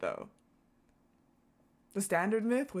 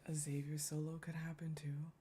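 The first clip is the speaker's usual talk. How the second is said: whisper; 4 semitones lower, 8 dB quieter than usual. Real, not acted. Muttering guess to herself.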